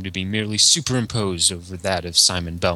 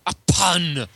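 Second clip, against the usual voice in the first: indignant; 6 semitones higher, 3 dB louder, slower than usual. Acted. Dracula outraged by pun.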